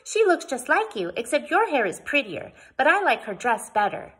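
put-on excited little girl's chatter, high and loud; Sudie. A narrator's reading. Child questioning Boston Jane about the newcomer, excited chatter. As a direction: gush like an eager small child. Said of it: "trying to slow the rush of words".

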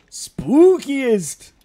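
shrieked high and loud, a cartoon spooky voice; spookiest. Imitating the Scooby-Doo game menu, loud high shriek. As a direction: climb up to a loud squeal on spookiest. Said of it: "the difficulties would go spooky".

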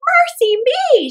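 high, loud, slow amazed exclaim; amazed cry. A narrator's reading. Old woman sees gold inside, amazed. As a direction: cry out in wonder. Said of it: "she cried, fair amazed".

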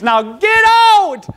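high, slow, loud mock command; commanding shout. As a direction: drive them out with a shout. Jesus drives out money changers.

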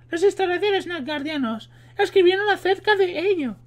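high put-on female voice; voz de mujer. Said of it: "estoy hasta los huevos de poner la voz de mujer". (hombre imitando voz de mujer)

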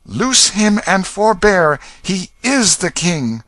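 high, loud, slow ringing command; ringing cry. A narrator's reading. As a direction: ring it out to stop the guards. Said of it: "cried out in a ringing voice".

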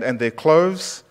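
ordinary delivery

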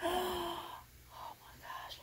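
slow, soft gasping whisper through tears; tearful whisper. Real, not acted. Looking at the doll, crying softly. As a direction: gasp it out while crying. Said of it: "my tears keep coming out".

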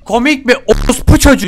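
loud, high furious outburst; angry shout. Real, not acted. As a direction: snap at the mocking enemy. Angry at the laughing enemy. (öfkeyle bağırarak)

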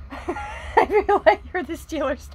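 high, giggling through the words; laughing. Real, not acted. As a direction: laugh it out, slowly. Laughing while talking.

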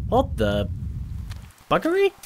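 buggery leaps very high in pitch; real startled what the buggery; shocked outburst. Real, not acted. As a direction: blurt it out in sudden shock. Sudden shock in the game.

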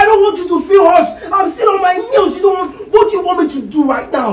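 heated, high-pitched protest; yelling. Acted. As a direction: yell it out high and exasperated. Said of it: "I'm sorry for yelling at you".

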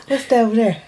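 normal talking voice

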